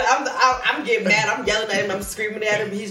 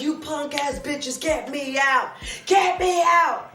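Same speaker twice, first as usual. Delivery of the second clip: high, slow yelled taunt at the guards; retold jail yelling; 9 semitones higher, slower than usual. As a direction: yell it from the cell, rising to a shriek. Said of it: "I was like".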